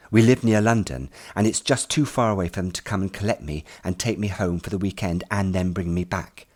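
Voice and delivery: plain reading voice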